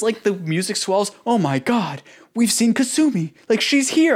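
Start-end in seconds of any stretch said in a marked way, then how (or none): 1.2-4.1 much higher and louder, hammed-up gush; mock excitement. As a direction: overplay the big reveal. Parodying the music-swell moment.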